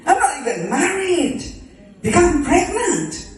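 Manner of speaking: high, aghast protest; scandalized disbelief. Acted. Unmarried Mary told she will become pregnant, scandalized disbelief. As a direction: protest it, voice jumping up.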